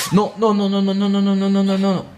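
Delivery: high, rapid string of no's in real panic; a gamer's frantic protest as it goes wrong; panicked no's. Real, not acted. Panicking as enemy grabs Ashley.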